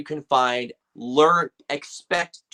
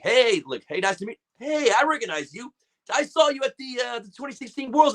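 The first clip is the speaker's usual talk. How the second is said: excited; 10 semitones higher than usual. Retold or imitated. Retelling excitedly.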